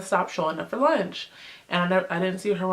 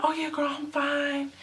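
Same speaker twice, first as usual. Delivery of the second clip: mock breathless, soft and higher; out of breath; 7 semitones higher, 5 dB quieter than usual. Friend rushing from the gym, mock out of breath. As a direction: puff out a quick brush-off. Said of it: "out of breath".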